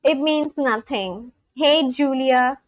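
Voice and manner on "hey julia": ordinary talk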